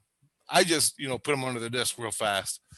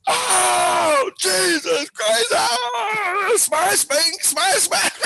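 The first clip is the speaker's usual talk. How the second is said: high crying shouts, much louder and higher; panicked screaming; 6 dB louder, much slower than usual. Retold or imitated. Man trapped in the illusion room, screaming in terror. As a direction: scream like a man terrified and smashing his room. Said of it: "he was flipping out, he was crying".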